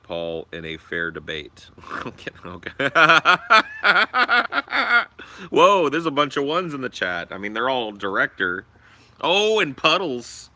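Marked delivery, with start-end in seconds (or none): 2.9-5.5 big high burst of laughter; loud high laugh. Real, not acted. Streamer laughing at chat votes, loud high laugh. 5.5-7.3 surprised. Real, not acted. Reacting to chat votes. 9.2-10.5 surprised. Real, not acted. Spots name in chat.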